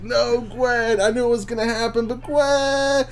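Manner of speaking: high, tearful plea; mock sobbing over a dead character; choked-up crying. Watching a sad movie scene, high tearful sobbing.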